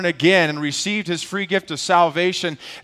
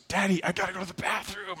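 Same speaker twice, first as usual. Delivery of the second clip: put-on soft, high, quick little-boy voice; small pretend child voice, hurried; voicing young son; 3 semitones higher, 11 dB quieter, faster than usual. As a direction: imitate a kid quietly telling dad he has to go. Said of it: "my son, Elijah, goes".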